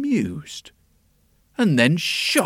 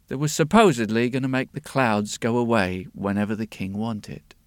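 plain reading voice

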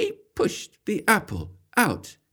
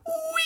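a tiny high squeal of delight; squeaky high whee; 8 dB quieter than usual. A narrator's reading. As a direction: squeal it like a falling apple. Apple falls from tree.

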